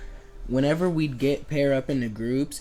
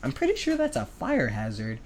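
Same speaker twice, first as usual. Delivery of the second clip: very high squeaky panic over a fire hazard; high mock-panicked kid; mock alarm; 13 semitones higher than usual. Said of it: "I'm like".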